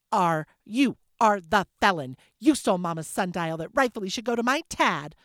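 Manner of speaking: loud, sputtering start; stammered retort. Acted. Isabel stammering back at Leah in the family quarrel, loud sputtering. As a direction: sputter with indignation. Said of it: "Isabel stammered at Leah".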